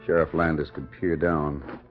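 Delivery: normal talking voice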